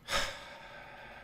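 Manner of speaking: long breathy sigh; exasperated sigh. Real, not acted. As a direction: let out a long, fed-up exhale. Reacting to a live-stream ban.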